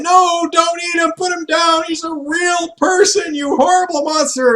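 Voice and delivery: high shrieking pleas, loud; high yelling. Retold or imitated. The foods scream at the human eating their friend, high and loud. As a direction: scream at the monster to put him down. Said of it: "they're yelling".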